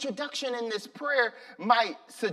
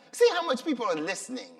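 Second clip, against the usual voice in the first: louder, quick jab at the crowd; mock exclaim; 4 semitones higher, 5 dB louder, much faster than usual. Real, not acted. Preacher teasing the crowd about listening, loud and fast.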